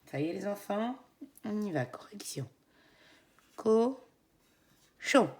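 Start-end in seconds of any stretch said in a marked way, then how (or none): 1.9-2.5 whisper. Real, not acted. 3.6-5.3 loud and high, drawn out co-chon; syllable split. Shown as a demonstration. Teacher to kids, syllables marked. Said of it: "Deux syllabes".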